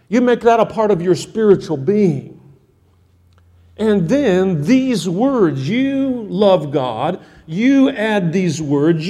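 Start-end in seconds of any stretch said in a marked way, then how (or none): 3.8-9.1 drawn-out, deliberate words; slow down and let each word land; slow emphatic preaching. Acted. Preacher pressing fathers to take the words to heart, slow and deliberate.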